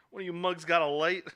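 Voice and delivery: high, loud movie-quote call; calling out, quoted. Retold or imitated. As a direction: call out to the mugs in imitation. Quotes man calling from roof.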